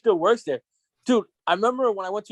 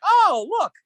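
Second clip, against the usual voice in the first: mock excited spotting; 10 semitones higher, 5 dB louder than usual. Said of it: "you're like".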